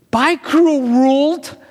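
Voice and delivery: slow, put-on voice waving off the cruel world; mock farewell. Shown as a demonstration. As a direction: drag out a mocking goodbye.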